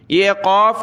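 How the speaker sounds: ordinary talk, nothing marked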